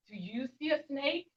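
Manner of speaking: ordinary delivery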